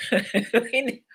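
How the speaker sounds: breaking into a laugh while talking. Real, not acted. Cracks up repeating her retold line.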